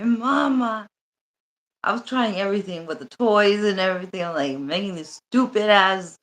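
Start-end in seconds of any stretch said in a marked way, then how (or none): 0.0-0.9 put-on coaxing baby talk. Shown as a demonstration.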